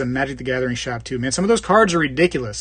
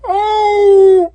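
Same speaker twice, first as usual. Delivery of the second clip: high, loud, drawn-out cat howl; mock yowling cat, much higher and louder; 9 dB louder than usual. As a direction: wail like a cat at the door. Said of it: "a real weird voice".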